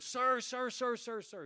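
high, urgent mock calls of sir; calling out after someone. Waitress chasing the pastor toward the door, calling out high.

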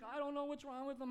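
high-pitched, lightly acted tearful distress; mock crying wife. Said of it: "his wife starts crying".

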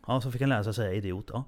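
ordinary delivery

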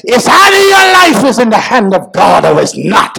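high, impassioned sermon cry; full-force preaching. Real, not acted. Preacher warning congregation about hard times, preaching at full force. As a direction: drive it hard, stretching the key words.

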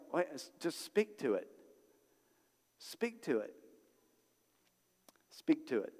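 soft, understated voice of God; quiet divine reply. Acted. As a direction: answer the pleading softly, with long pauses between repeats. God's reply, voiced after the begging.

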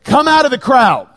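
God calling. Retold or imitated. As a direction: call her out of the crowd with urgency. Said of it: "when God says".

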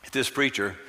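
usual speaking voice

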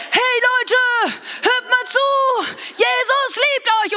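high, loud proclaiming shout; herald calling out. Pretend. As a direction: announce it from the desktop. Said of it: "wie ein Herold etwas ausrufen".

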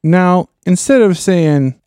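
usual speaking voice

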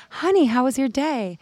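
bright loving hello at the door; sweet wife greeting. Retold or imitated. Wife greeting her husband home, high cheery voice.

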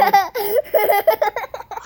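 loud, high burst of laughter; high gleeful laugh. A narrator's reading. Narrator laughs out at the losing girls, high and gleeful. Laugh after the boys pass.

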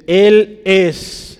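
ÉL and ES each hit high and loud; "Él es" rung out loudly as a proclamation; emphatic scripture quote. Real, not acted. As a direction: proclaim "Él es" word by word, punched.

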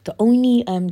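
usual speaking voice

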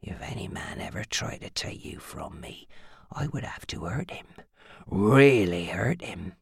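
low threatening whisper, slowing at the end; harsh whisper. A narrator's reading. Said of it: "he whispered harshly".

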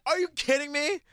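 can't believe the question; incredulous. Real, not acted. Incredulous reaction.

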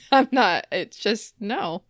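usual speaking voice

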